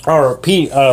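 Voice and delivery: normal talking voice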